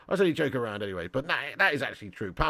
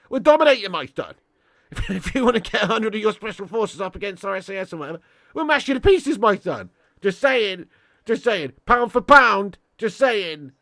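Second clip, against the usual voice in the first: cocky boast; 6 semitones higher, 8 dB louder than usual. Real, not acted. Mock boasting at American viewers.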